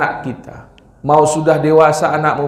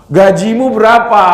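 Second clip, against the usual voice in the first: louder, demanding question; preacher imitating a father asking his son's salary; imitated greedy father; 4 semitones higher, 5 dB louder than usual. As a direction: bark it like a nosy father. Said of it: "bapaknya setiap ketemu, nanya duit mulu".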